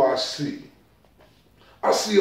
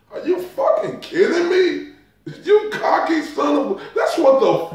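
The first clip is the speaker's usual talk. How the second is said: laughing disbelief; 8 semitones higher than usual. Real, not acted. Incredulous outburst.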